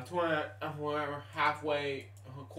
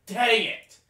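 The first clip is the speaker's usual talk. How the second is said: loud, high curse at the loss; real irritated yell; frustrated outburst; 10 semitones higher, 13 dB louder than usual. Real, not acted. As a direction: spit it out, annoyed. Loss right on the bat.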